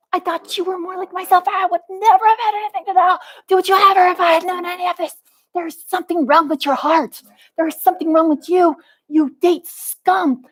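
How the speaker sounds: high, heated accusations hurled at him; furious rant. Real, not acted.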